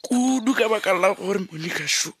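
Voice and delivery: high, loud grieving wail; wailing grief. Acted. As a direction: cry out in despair, voice breaking. Father wailing over son's death.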